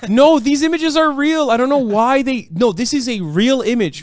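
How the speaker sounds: loud, high-pitched protest; emphatic insisting. Real, not acted. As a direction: insist at the top of your voice. Insists the disputed images are real.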